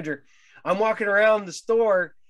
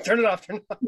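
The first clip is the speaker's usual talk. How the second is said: laughing, higher panic; laughing retell; 5 semitones higher, much faster than usual. Retold or imitated. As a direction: blurt it through a laugh. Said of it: "and I'm like".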